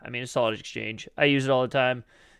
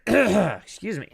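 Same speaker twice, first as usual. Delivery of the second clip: voice cracking; 7 semitones higher, 6 dB louder, much slower than usual. Real, not acted. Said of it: "why my voice is cracking today".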